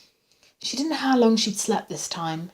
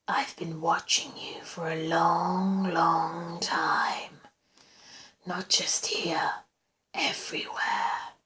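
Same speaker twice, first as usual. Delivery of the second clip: very slow, creepy whisper; obsessive whisper; 4 dB quieter, much slower than usual. A narrator's reading. Stalker confessing he watches everywhere, obsessive whisper. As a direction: draw out each phrase in a hush.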